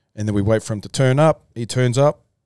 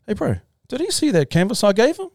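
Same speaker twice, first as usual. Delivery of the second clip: high, eager call to a team member; anxious high ask; urgent question; 10 semitones higher than usual. Retold or imitated. Asking the team member backstage, high and eager.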